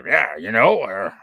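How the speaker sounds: punchy, rough Quebec French twang; rough Quebec accent. Shown as a demonstration. Quebecer showing how rough it sounds over here, rough Quebec accent. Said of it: "it's, like, rough".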